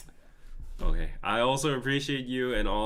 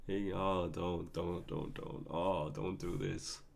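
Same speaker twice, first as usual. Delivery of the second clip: choked up; 3 semitones lower, 7 dB quieter, slower than usual. Real, not acted. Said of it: "I might tear up".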